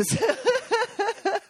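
real burst of giggles; tickled, high-pitched laughter; high giggling laugh. Real, not acted. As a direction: laugh at your own confession, high and helpless. Laughs at her own confession.

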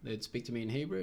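plain reading voice

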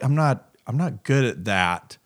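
normal talking voice

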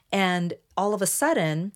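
usual speaking voice

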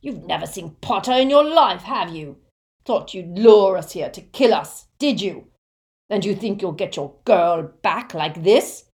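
loud, bullying interrogation; rough, raging wizard; angry shout. A narrator's reading. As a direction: shout the accusations at the victim. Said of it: "shouted the wizard named Selwyn".

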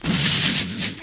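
long hissing taser noise; imitated taser zap. It goes after he is shot, imitated taser noise. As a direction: make a voiceless electric zap noise.

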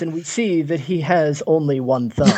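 usual speaking voice

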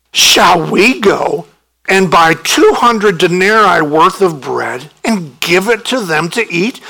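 disciples snapping back. Pretend. Disciples snap back at Jesus.

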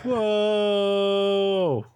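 long, high crowd whoa; drawn-out cheer of amazement; cheering onlooker. Acted. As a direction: whoop like an awed spectator. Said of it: "are clapping and cheering".

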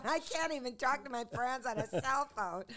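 high, loud mock complaint; hammed-up indignant wail, pitched high; retold outrage. Retelling envy of a cell phone, comically aggrieved. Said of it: "I was so pissed".

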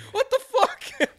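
a high squeaky shocked "what the fuck", half laughing; shocked husband. Retold or imitated. Prank call retold to friends, husband's high shocked squeal. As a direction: squeal it like a stunned husband caught off guard. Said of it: "The guy's like".

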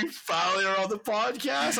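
high, loud excited gush; excited squeal. Retold or imitated. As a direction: squeal it like a thrilled fan. Retelling his excitement at invitation.

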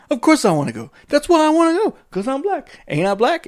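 high, loud incredulous voice; high indignant quote. Retold or imitated. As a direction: push it up, outraged and high. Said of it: "He's like".

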